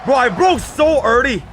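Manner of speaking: loud, high frustrated shout; frustrated yell. Real, not acted. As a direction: yell it at the screen in disbelief. Yelling at his own crash.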